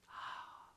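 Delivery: breathless voiceless ah; a hushed gasp of awe; only breath, ah in wonder; whispered ah of wonder. Shown as a demonstration. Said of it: "Is it wonder?".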